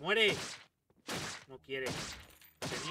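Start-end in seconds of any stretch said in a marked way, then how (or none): 0.0-0.3 loud "¡Muere!" at the enemy; yelled command. Real, not acted. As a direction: bark it as you finish him. Finishing off an enemy.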